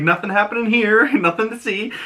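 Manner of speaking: high, breezy fake innocence with a laugh; giggly sing-song denial; mock innocent. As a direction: play it like nothing's wrong, squeaky high. Characters feign innocence over stolen money.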